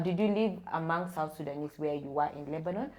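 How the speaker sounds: normal talking voice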